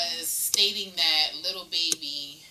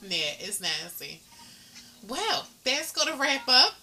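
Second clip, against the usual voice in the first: ordinary talk, nothing marked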